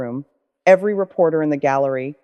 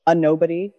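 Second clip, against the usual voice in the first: plain reading voice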